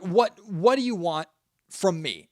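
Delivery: sincere, unhurried ask, nothing defensive; genuine question. Shown as a demonstration. Podcast host sincerely asking his listeners, earnest and plain. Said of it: "And I'm being genuine".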